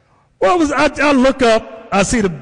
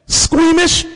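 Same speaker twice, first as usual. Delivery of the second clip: ordinary talk, nothing marked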